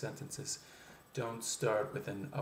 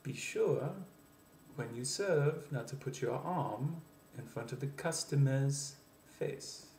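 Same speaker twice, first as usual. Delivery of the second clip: French-accented English, pitched up on SURE, SERVE and ARM; 3 semitones higher than usual. Shown as a demonstration. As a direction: lilt each phrase upward like a French speaker. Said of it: "a French person, sounding a little bit like this".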